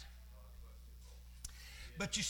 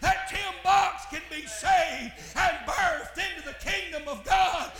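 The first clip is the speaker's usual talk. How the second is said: full-force preaching; 6 semitones higher, 9 dB louder than usual. Acted. Preacher shouting to the congregation about the cross, full force. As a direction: preach it out over the organ.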